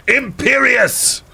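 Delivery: high, drawn-out mock incantation; Voldemort's shouted spell. Villain cursing the heroine, theatrical shout. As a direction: cast the curse with hammed-up force. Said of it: "but then Voldemort shouted".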